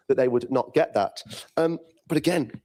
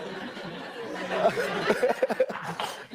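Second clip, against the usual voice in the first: breathy laugh; 6 semitones higher, 5 dB quieter than usual. Real, not acted. Laughs at rival's jibe.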